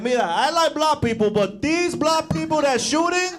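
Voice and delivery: hammed-up Spanish-accented mom. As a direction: lecture like an offended abuela. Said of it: "she's like".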